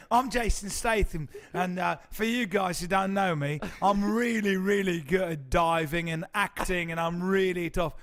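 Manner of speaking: loud, hammed-up English tough guy; laughing, badly faked English action-star accent; Jason Statham. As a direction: brag in a put-on English accent. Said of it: "pretending you were Jason Statham".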